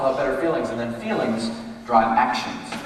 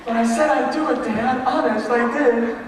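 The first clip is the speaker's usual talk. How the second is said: higher, loud, drawn-out protest; pleading son; 8 semitones higher, 4 dB louder than usual. Retold or imitated. Son protesting to Dad he agreed, pleading. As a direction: beg Dad to believe you.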